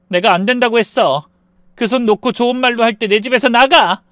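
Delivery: firm, rising to a sharp command; angry order. A narrator's reading. Wife ordering ex-husband out of her house, angry. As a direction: throw him out of the house.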